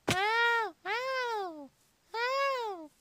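loud, drawn-out ow; repeated yelps. Real, not acted. Player getting hurt in game, repeated yelps. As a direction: yelp at each hit.

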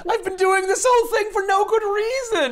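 very high, loud comic wail; over-the-top mock-dramatic squeal; hammed-up cartoon villain. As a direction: wail it as a ridiculous villain revealing himself. Villain Mephisto's reveal acted out.

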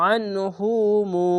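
slow আনহুমু opening on a thick, tight-throated আ; throat-squeezed ain. Shown as a demonstration. Teacher modelling আনহুমু, slow with heavy ain. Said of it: "আইনটিকে গলা চিপে মোটা করে পড়বেন".

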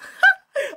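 high giggling burst; a sudden high laugh; burst of laughter. Real, not acted. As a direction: laugh out loud at yourself.

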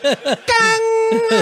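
very high, whooping yell; mock rally cry. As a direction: howl it like a team chant. Mock cheer after mom's speech.

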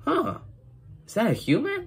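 squeaky high surprised pony voice; high character voice. Retold or imitated. Kid retelling a cartoon pony meeting a human, squeaky. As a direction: pitch it way up, wide-eyed. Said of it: "It's like".

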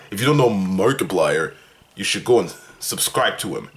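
lightly voiced Markiplier, deep and low; Markiplier impression. Telling viewers to subscribe, in a lightly deep Markiplier voice. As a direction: drop into a hint of Markiplier's deep YouTuber voice. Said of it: "That's how he talks".

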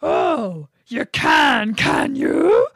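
loud, high growled challenge; growling bear. Acted. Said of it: "growled the bear".

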